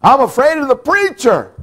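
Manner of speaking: high, loud mock-timid wail; mock frightened whine; imitating a frightened woman. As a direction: play a scared churchwoman on the phone. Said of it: "She would call Missus Carpenter".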